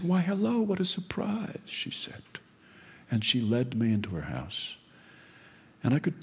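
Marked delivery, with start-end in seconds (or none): 0.0-1.6 put-on a proper old lady covering up; sweet old mother. Old mother greeting her son at the door, high and gracious.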